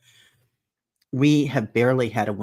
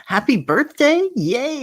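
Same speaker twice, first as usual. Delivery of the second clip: excited cheer; 14 semitones higher, 4 dB louder, slower than usual. Real, not acted. Cheering a viewer's birthday.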